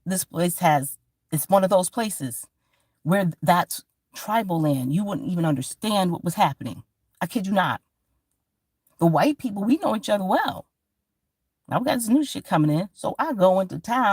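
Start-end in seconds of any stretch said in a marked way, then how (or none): none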